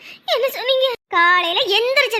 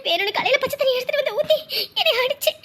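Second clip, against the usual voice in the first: normal talking voice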